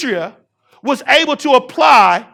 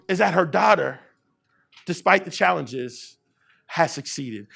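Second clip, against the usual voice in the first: dropping much softer and lower; soft, low, intimate close to the story; hushed; 7 semitones lower, 9 dB quieter than usual. Real, not acted. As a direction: let it fall to a near hush. Quiet climax of the story.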